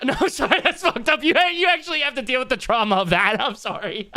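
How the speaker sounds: giggling through a guilty sorry; real laughter breaking into the apology; laughing apology. Real, not acted. Laughing apology after dark joke.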